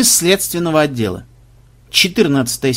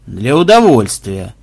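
grumbled; 3 semitones lower, 5 dB louder, slower than usual. A narrator's reading. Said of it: "буркнул палач".

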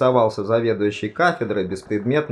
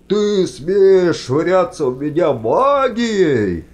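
loud, slow roar at a much higher pitch; outraged roar; 8 semitones higher, 6 dB louder, slower than usual. A narrator's reading. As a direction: let all the breath out in one roar. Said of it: "воздух вышел вместе с рёвом". (яростный протяжный рёв)

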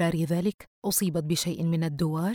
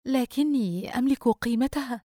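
higher-pitched, embarrassed protest; 6 semitones higher than usual. A narrator's reading. Girl to old shopkeeper who waived payment, embarrassed. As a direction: object shyly in a higher voice. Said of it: "وهي تشعر بالخجل".

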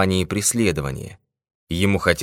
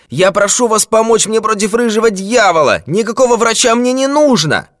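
put-on very high, loud outraged old man; roaring; 8 dB louder than usual. A narrator's reading. Old man rejecting doctors, demanding help, roaring. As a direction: bellow at the top of the voice. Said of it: "заревел старик".

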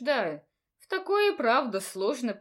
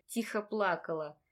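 usual speaking voice

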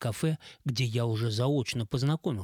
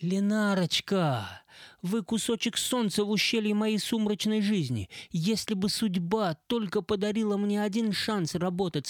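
breathy flatterer; 8 semitones higher than usual. Acted. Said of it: "придыхательным стилем общения".

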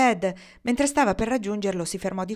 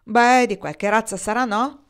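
loud, higher-pitched exclamation; raised voice, pushing for an answer; exclaiming; 3 semitones higher, 7 dB louder than usual. A narrator's reading. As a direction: call it out emphatically. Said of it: "esclamò Bill".

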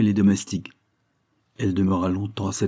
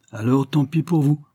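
higher-pitched exclamation; exclaiming; 6 semitones higher, 4 dB louder than usual. A narrator's reading. Judge reproaches the lawyer, exclaiming. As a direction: exclaim it with a moderate lift. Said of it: "s'écria le juge".

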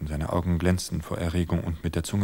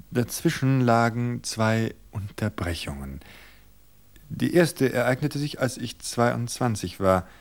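higher, measured confession; wife's invented story; 4 semitones higher, 3 dB louder than usual. Acted. Wife confessing an invented past to her husband, measured.

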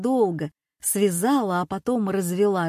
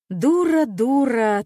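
loud, high self-scolding; self-reproach; 5 semitones higher, 7 dB louder, slower than usual. A narrator's reading. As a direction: berate yourself, pitching the first word highest. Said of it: "твердила она про себя".

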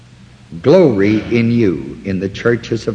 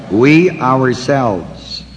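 slow, leaning hard on "We"; stress on we; much slower than usual. Shown as a demonstration. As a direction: drag out "We ourselves", with "We" weighted. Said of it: "So the emphasis is on the we".

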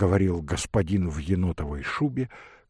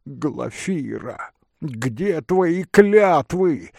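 slow, drawn-out reproach, swelling louder; crying out; 6 semitones higher, 4 dB louder, much slower than usual. Acted. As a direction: cry out like a betrayed lover. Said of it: "вскричал молодой человек в бекеше". (протяжный возглас с упрёком)

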